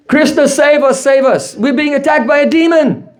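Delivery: high-pitched acted alarm; cry for help. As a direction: pretend panic, calling to be rescued. Boys cry for help from demon.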